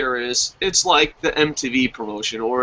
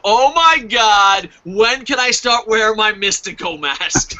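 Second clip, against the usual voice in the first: higher, louder, stretched-out gushing; fanboy excitement; 4 semitones higher, 3 dB louder, slower than usual. Retold or imitated. Fan eager to wear his Mystico mask, gushing. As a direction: gush with drawn-out starstruck glee.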